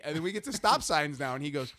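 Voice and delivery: ordinary talk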